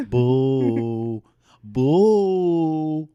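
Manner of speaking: long, loud, drawn-out ghostly boo; hammed-up spooky wail; mock ghost. Playing a spooky inmate ghost.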